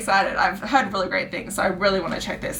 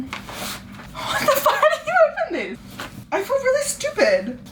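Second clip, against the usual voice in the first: laughing frustration. Real, not acted. Can't open the package.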